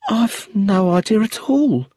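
high, slow, shaken answer; frightened widow. Acted. Widow staring at the inspector, high and shaken. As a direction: hold back fear as you answer.

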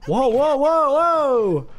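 high, rising whoas of surprise; startled whoa. Real, not acted. Reacting to the game with high startled whoas. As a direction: caught off guard by something strange.

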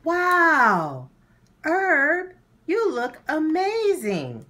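overjoyed wow at the outfit; amazed. Acted. Muriel sees Herb's new outfit, high loud delight.

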